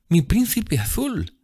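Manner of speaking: very high, drawn-out adoring voice; rapturous. A narrator's reading. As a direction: breathe out the name of a beloved, slowly and high. Sibyl sighting her beloved. (embelesada, lenta y muy aguda)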